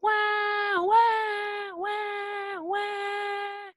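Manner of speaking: high wailing "wah"; mock baby crying. Shown as a demonstration. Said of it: "A baby crying".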